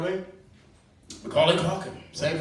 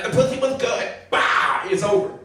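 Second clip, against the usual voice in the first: high, louder mock Tyson boast; hammed-up Tyson bragging; Mike Tyson impression; 9 semitones higher, 5 dB louder than usual. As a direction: bark it like a gloating boxer. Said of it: "Tyson be like".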